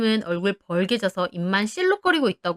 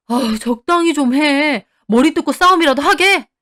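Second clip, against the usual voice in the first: loud, higher, fed-up reprimand; building from annoyance to a shout; exasperated scolding; 3 semitones higher, 6 dB louder than usual. A narrator's reading. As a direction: tell a spouse off, at the end of patience. Husband fed up with wife's fighting.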